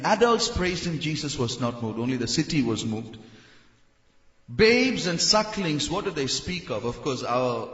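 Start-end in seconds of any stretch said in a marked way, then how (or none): none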